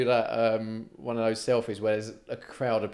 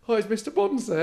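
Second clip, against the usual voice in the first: nervous polite ask; 9 semitones higher than usual. Retold or imitated. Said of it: "And I".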